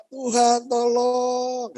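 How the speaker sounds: high, loud, drawn-out mock plea; rengekan seperti bayi. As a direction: wail for help like a helpless baby. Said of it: "Seperti bayi. Nangis". (merengek panjang seperti bayi minta tolong)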